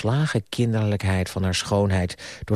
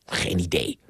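snappish; 6 semitones lower, 3 dB quieter than usual. A narrator's reading. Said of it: "zei Ardita bits".

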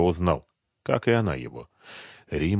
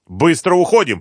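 loud, high urgent order; commanding; 10 semitones higher, 7 dB louder than usual. A narrator's reading. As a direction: order the gang out now. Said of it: "приказал Малявка".

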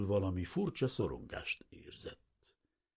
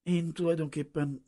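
higher, hesitant answer; stammering; 8 semitones higher, 4 dB louder than usual. A narrator's reading. Refugee answering the unfriendly official, stammering. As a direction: falter nervously in a higher voice.

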